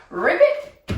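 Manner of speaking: hard frog ribbit. Pretend. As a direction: call it out with a stomping hop. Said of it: "I'm gonna go really hard now".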